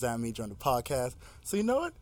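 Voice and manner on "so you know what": mock challenge. Host playfully escalating against guest.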